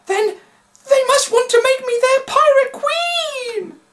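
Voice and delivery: high, loud, wailing woman; wailing Annie Beth. A narrator's reading. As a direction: shriek it in despair, very high. Annie Beth's voice.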